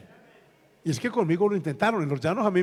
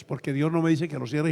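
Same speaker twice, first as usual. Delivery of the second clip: ordinary talk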